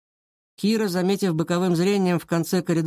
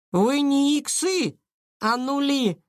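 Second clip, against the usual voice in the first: high, slow schoolgirl scorn; spat-out contempt; 9 semitones higher, slower than usual. A narrator's reading. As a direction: spit out the insult in a high voice. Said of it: "выплюнула Таня". (презрительно, высоким девчачьим голосом)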